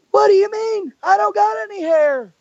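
very high, hammed-up Arn voice; Arn impression. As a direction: squeak out the mock protest. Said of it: "everybody has their own Arn impersonation".

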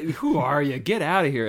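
loud, hammed-up indignation; playful telling-off; mock annoyance. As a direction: shoo an intruder away loudly. Acting out annoyance at Ethel intruding.

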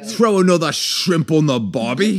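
loud-and-proud, slow Australian caricature; Australian accent. Acted. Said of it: "That's Australian".

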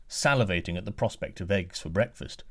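ordinary delivery

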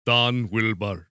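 put-on slow, low Russian accent with a W; corrected mock-Russian take on the name. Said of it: "No, that was German".

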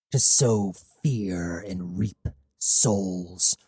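slow, low chilling whisper; menacing whisper. Acted. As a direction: breathe it out like a threat. Menacing mercenary confession.

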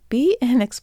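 loud, broad Boston accent slipping out. Real, not acted. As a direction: let the Boston accent come through. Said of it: "there's my Boston accent coming out".

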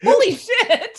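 high, loud shocked yell; shocked shout. Real, not acted. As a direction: shout in disbelief. Reacting to a critical roll.

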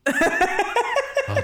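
high laughing oh; real laughing mock surprise; mock taken aback. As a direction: act stung but amused. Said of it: "And I'm like".

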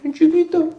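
very high squeak for bien chiquito; squeaky tiny voice. Shown as a demonstration. Mocking a tiny mouth. (voz aguda de boca chiquita)

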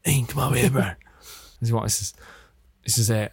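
lightly put-on Australian film-star voice; Russell Crowe's voice. Star pulling a crewmate aside, lightly imitated Australian voice. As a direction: imitate a blokey Australian actor, understated. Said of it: "Stop doing the voice".

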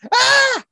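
short, high, loud comic scream; mock scream. Spider bit with friend, high-pitched pretend scream. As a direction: shriek very high in play.

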